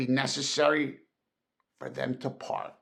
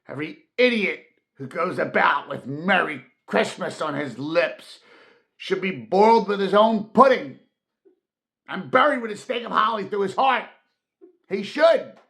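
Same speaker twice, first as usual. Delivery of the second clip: building higher and louder, faster at the end; indignant fury; 5 semitones higher, 6 dB louder than usual. Acted. Scrooge raging at Christmas revellers, indignant fury. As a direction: rage rising to a furious peak. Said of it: "said Scrooge indignantly".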